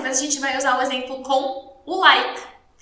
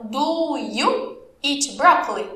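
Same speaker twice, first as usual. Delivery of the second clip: very slow question with a drawn-out "Do"; slow model question; much slower than usual. Shown as a demonstration. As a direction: stretch "Do you", pause, then finish. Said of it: "O do, ele vai vir no". (pergunta bem lenta, alongando o "Do")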